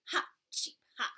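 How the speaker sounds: whispered little bird laugh; cheeping bird. Acted. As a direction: titter like a tiny bird. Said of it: "cheeped the bird".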